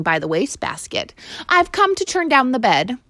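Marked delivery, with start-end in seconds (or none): 1.5-3.0 higher, polite maid. Acted. Maid explaining to the boy, politely. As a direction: state your duty.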